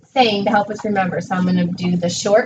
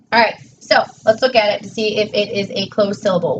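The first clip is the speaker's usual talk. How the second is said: ordinary talk, nothing marked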